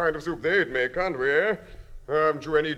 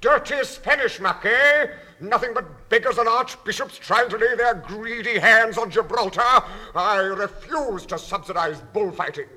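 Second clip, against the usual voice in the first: louder, higher blustering tirade; indignant rant; 7 semitones higher, 6 dB louder than usual. Acted. Diner ranting at the waiter, indignant and loud. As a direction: bluster up into outrage.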